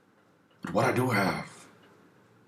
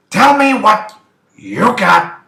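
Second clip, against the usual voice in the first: much higher, much louder shouted demand; over-the-top shouted demand; yelled demand; 13 semitones higher, 13 dB louder than usual. Acted. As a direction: explode with the demand.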